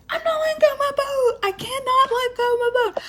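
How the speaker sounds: high, whiny insistence; cling to it, squeaking no; high-pitched stubborn wail over the boat; stubborn refusal. Retold or imitated. She refuses to drop her boat.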